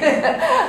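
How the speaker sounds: bright rising laugh; a high amused giggle. Real, not acted. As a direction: laugh at the punchline. Laughs at own joke.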